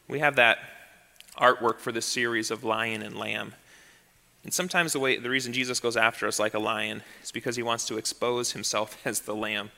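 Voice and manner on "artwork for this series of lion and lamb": ordinary delivery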